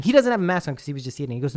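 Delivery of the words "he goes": normal talking voice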